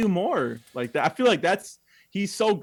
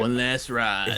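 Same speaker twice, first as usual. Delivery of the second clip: slow, heavy Vin Diesel line; a light slowed-down Dom Toretto imitation; Dom Toretto impression; 3 semitones lower, slower than usual. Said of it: "As Dom, Vin Diesel, Dom Toretto would say".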